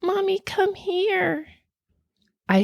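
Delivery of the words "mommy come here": put-on high, slow little-girl voice; a child beckoning from the dark; ghostly child's call. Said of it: "hear my oldest say".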